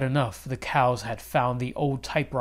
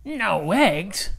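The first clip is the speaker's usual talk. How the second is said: dismayed cry, pitched up; cried out; 6 semitones higher, 4 dB louder than usual. Acted. Farmer reading the cows' second note, crying out in dismay. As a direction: farmer cries out in disbelief. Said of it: "cried Farmer Brown".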